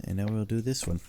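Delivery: ordinary talk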